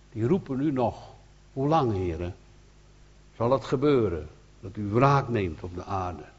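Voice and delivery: usual speaking voice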